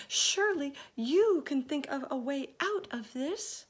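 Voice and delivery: high, pleading; appealing. A narrator's reading. Ladybug appealing to James for help. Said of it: "appealing to James".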